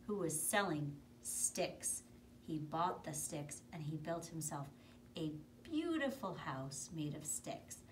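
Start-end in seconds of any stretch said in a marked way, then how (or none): none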